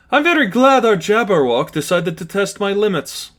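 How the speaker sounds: high, smug villain voice; gloating villain. Acted. Lipov gloating over the shocked giant, smug villain voice. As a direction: savour the cruelty. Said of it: "Lipov said".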